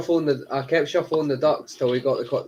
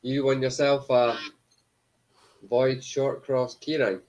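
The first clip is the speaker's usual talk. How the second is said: announcer voice; slower than usual. Acted. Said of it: "announcing the prize".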